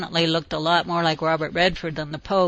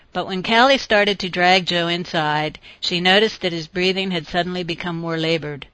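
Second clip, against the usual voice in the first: plain reading voice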